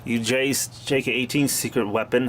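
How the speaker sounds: ordinary talk, nothing marked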